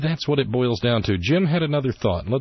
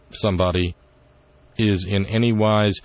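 usual speaking voice

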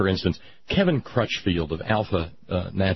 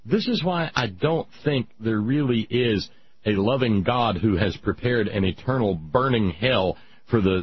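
normal talking voice

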